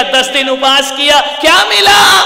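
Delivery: loud, high, mocking shout; yelled at the fasting man, mock indignant; challenging question. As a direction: fling the question like a scornful heckler. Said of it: "कोई पूछेगा".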